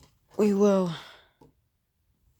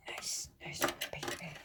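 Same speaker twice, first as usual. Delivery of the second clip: pleased whisper; 10 dB quieter than usual. Real, not acted. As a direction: breathe it out, delighted. Delighted over the driver, whispered.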